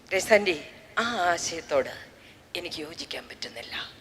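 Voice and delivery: low hushed schoolboy voice, ending in a whisper; a shy boy murmuring his doubt, trailing into a whisper; quiet boy confiding. Retold or imitated. Boy telling Grace after class he disagrees, hushed and low.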